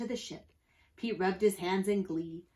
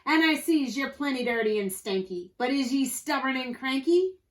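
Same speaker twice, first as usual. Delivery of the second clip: louder, higher pirate quizzing a recruit; Pete, pirate captain; 5 semitones higher, 5 dB louder than usual. A narrator's reading. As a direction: challenge him in pirate talk.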